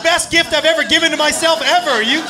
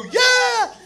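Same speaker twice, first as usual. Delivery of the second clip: high cheering yeah; crowd cheer; 11 semitones higher than usual. Retold or imitated. Friends cheer her on, high excited yeah. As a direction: cheer like wrestling fans. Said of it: "we go".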